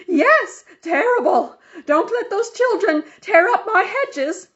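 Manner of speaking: very high, loud pained yell; yelling back. Acted. Injured Missus Armstrong yelling back to the neighbor, very high and loud. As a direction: shout it back, hurt but still bossing. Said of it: "yelled back".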